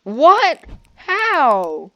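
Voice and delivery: high loud startled what; shocked disbelief. Real, not acted. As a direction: gasp it out in disbelief. Shocked at hundred iron.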